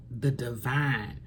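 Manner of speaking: ordinary talk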